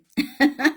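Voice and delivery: high, loud embarrassed laugh; burst of laughter. Real, not acted. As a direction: laugh it off, a little flustered. Embarrassed after forgetting name.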